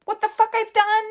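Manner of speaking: a proud challenge, voice leaping high at the end; incredulous high exclaim. Retold or imitated. To those who called her a failure, high excited challenge. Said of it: "I'm like".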